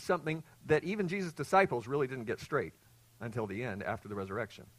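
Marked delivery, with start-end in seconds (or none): none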